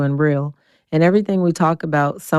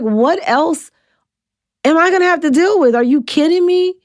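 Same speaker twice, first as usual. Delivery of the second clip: exasperated disbelief; 11 semitones higher than usual. Retold or imitated. Reacting to tumor news, tearing up.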